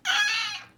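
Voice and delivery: high sudden cry of alarm; panicked shriek. Acted. Boy shrieks as friend lifts the pie. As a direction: yelp in fright.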